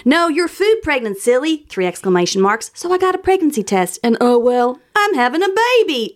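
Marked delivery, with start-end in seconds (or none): 0.0-1.7 high-pitched mock Southern voice; put-on Southern lilt, pitched up; amateur Southern accent. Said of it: "I don't know what the Southern accent is". 2.7-6.2 high, hammed-up Southern voice; mock Southern, swooping up high; amateur Southern accent. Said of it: "I don't know what the Southern accent is".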